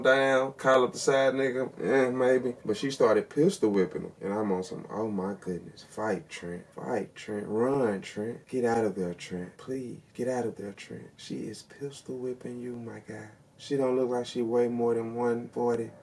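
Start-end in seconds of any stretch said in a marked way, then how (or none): 4.9-13.3 hushed urging. Retold or imitated. Viewer begging Trent to get out, hushed urging. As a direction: urge him on under your breath.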